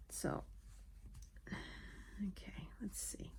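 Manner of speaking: breathy whispered okay; whispering. Real, not acted. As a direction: murmur it under your breath. Whispering while concentrating.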